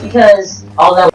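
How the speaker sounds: ordinary talk, nothing marked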